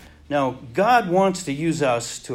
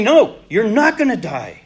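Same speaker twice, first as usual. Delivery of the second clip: emphatic reassurance; 5 semitones higher, 8 dB louder than usual. Acted. God answering Gideon emphatically.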